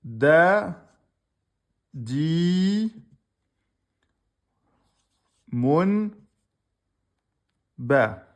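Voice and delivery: loud spelled-out syllables, each on its own; sounding out a word slowly for students. Shown as a demonstration. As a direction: call out each syllable for the class. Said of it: "Ils ont écrit".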